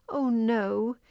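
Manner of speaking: high-pitched, rising dismay; dismayed cry. A narrator's reading. Fairy finds the door locked, crying out in dismay. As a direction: cry out in alarm, pitch climbing. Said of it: "cried Ruby".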